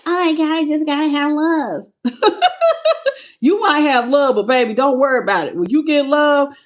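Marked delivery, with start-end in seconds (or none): 0.1-1.8 lovesick mock voice, laughing; teasing mock voice; mocking the haters. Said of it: "they thought you was just out here". 2.0-3.1 sudden high burst of laughter; real high-pitched laugh. Real, not acted. Laughs at own mock quote.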